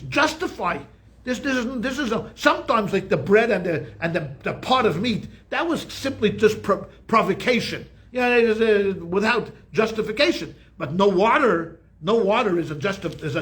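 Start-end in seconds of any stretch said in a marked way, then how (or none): none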